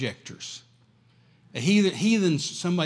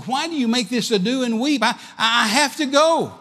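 high, loud and pleading; an apostle crying out to his friends that he has to go; Paul's fervent reply; 9 semitones higher, 5 dB louder than usual. Acted. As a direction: lift it high and insist you must go. Paul answers weeping friends.

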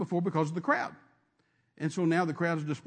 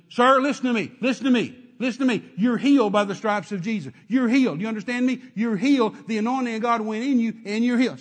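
loud, higher, insistent; urgent command; 4 semitones higher, 7 dB louder than usual. Retold or imitated. Preacher nose to nose urging the man, loud and insistent. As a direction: drive it into his face. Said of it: "And I said".

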